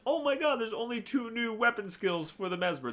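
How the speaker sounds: high mock dismay; mock complainer. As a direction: play a whiny player, lightly. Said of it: "can't just get focused on the fact".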